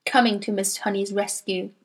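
ordinary delivery, nothing marked

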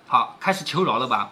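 usual speaking voice

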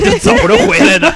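high giggling laugh through the words. Real, not acted. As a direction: laugh it out at your friend. Teasing laugh at friend. (边笑边说)

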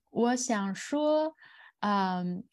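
ordinary talk

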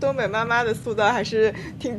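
usual speaking voice

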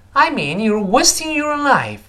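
"I mean you're wasting your life" high and slow, "wasting" and "life" stressed; emphatic stress. Shown as a demonstration. Teacher rereads for students to follow, "wasting" and "life" heavily stressed. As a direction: stretch "I mean you're wasting your life", punching "wasting" before a final fall. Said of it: "要重读".

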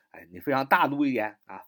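usual speaking voice

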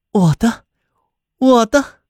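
high, rising repeated call; echoing claim. A narrator's reading. The Ice Maiden claims Rudy as hers, high echoing call. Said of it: "鲁迪的四周在回响".

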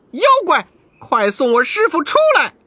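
high shouting challenge. A narrator's reading. Monkey King shouting at the demons' cave door. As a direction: yell for the master back. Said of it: "叫声".

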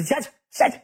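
high-pitched retold protest; urgent shooing. Man on the phone waving a woman off, urgent shooing. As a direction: shoo her away in a high, hurried voice.

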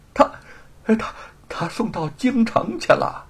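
high, halting, fearful answer; frightened stammer. Acted. Thief telling where the manuscript went, frightened stammer. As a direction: stammer it out under threat.

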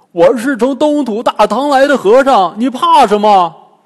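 put-on loud, high shout after a fleeing girl; Bajie calling out. A narrator's reading. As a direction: call her back at the top of his voice. (八戒高声大喊)

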